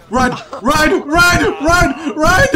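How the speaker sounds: loud, high repeated yells; real panicked yells; shouting run. Real, not acted. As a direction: scream at them to run. Urging team to flee.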